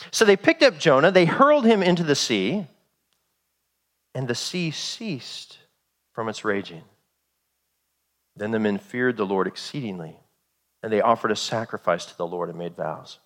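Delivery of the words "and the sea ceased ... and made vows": low, soft, awed scripture reading; hushed reading. A narrator's reading. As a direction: drop to a hush as the storm dies. Storm suddenly stills in reading.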